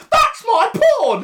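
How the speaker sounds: high, slow mock squeal; stretched high mock outcry. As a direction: squeal it like a panicked crewman. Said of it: "This guy's like".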